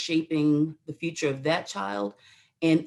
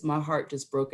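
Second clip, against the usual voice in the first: ordinary delivery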